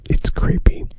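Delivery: loud creepy stage whisper. Pretend. Co-host repeating his creepy aside, close-mic whisper. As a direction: whisper right into the microphone. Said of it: "I was whispering".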